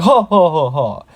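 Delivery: loud, high-starting villain ha-ha; hammed-up boss laugh, falling away; orc shaman's laugh. Orc shaman laughing at Deku's taunt, loud mock villain laugh. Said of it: "The orc shaman starts laughing".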